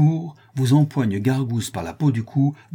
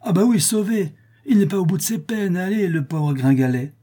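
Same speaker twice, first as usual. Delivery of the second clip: high, animated contradiction; animated retort, easing down at the end; scoffing exclamation; 9 semitones higher, 3 dB louder than usual. A narrator's reading. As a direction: cry out that it's far from over. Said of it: "s'écria Pique-Vinaigre".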